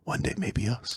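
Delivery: hushed whisper. Real, not acted. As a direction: whisper it like a secret wish. Hopeful joke about fame.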